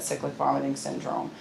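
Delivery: usual speaking voice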